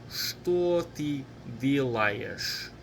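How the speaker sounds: very slow, drawn-out words for learners; slow model pronunciation. Shown as a demonstration. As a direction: stretch out every word of the question. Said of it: "Or faster".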